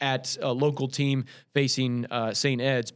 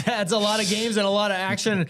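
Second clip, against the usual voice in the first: higher, laughing as he speaks; 5 semitones higher than usual. Real, not acted. Co-host reacts to the long list of games, laughing. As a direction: laugh through the words in amusement. Amused by co-host's breathless list.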